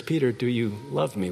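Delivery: soft, low and tender; gentle. Acted. Jesus gently asking Peter, soft tender question. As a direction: ask it gently, like a friend. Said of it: "Jesus gently comes alongside Peter and says".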